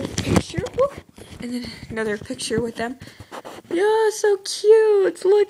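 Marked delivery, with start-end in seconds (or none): 3.7-5.5 high, slow, squealing "so cute"; gushing squeal. Real, not acted. Vlogger gushing to viewers over her friend's drawing, squealing.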